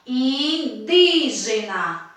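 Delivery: indígena drawn out very slowly, DÍ stretched; slow syllable-by-syllable indígena, strong DÍ; stress on DÍ in indígena. Shown as a demonstration. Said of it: "Qual é a sílaba?".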